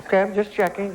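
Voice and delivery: ordinary delivery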